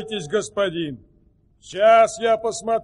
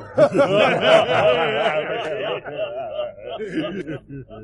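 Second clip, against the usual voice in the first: hearty laugh; 6 semitones lower than usual. Acted. Servant laughs at acorn story.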